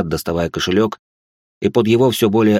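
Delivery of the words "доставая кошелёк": ordinary delivery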